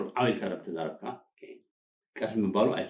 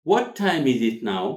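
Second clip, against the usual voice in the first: louder, words run together; natural linked delivery; 3 semitones higher, 7 dB louder, much slower than usual. Shown as a demonstration. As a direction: model it as one connected phrase. Teacher models natural linked phrase.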